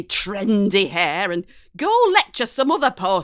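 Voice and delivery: loud, bitter telling-off; angry mum. A narrator's reading. Mum furiously ordering her daughter out of the room, loud. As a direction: throw her out loudly and scornfully.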